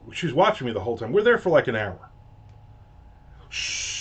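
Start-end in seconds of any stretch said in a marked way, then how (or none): 3.5-4.0 soft shushing hiss like a coffee machine; breathy shh of coffee brewing. Shown as a demonstration. Showing viewers how the coffee machine sounds, soft hiss. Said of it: "That's coffee being made".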